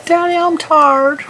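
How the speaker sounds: high, slow Southern drawl imitating a child. Imitating a little cousin whining to her dad in Southern drawl. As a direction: drag out Daddy I'm tired in a thick Southern twang. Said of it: "a serious Southern drawl in her voice".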